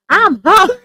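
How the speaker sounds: put-on high, loud cranky demand from a hungry child; mad kid. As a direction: whine it like a grumpy little girl off the bus. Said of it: "She's like".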